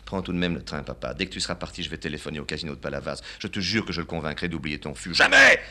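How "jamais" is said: very high, loud yell; father howling his refusal. Acted. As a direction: howl the word Jamais. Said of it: "Ne hurle pas".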